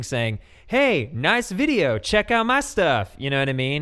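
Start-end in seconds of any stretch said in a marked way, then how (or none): 0.7-3.1 higher, drawn-out mock commenter; bold self-promotion. Imitating a commenter plugging their channel, mock bold pitch. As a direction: imitate a pushy plug. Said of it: "it's not as bold as just commenting on literally everything".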